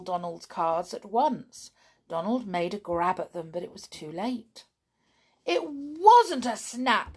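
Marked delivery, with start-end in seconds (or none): 5.4-7.2 "It wasn't a snap" said higher and louder, slowed, on the verge of tears; almost crying. Acted. Donald losing his cards, near tears, says "It wasn't a snap". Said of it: "he said, almost crying".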